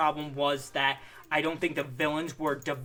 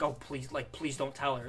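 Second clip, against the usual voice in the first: much softer begging; pleading quietly; 3 semitones lower, 8 dB quieter than usual. Retold or imitated. Vlogger imitating Johnny begging secretly, soft plea. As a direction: beg under the breath. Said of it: "Johnny's like".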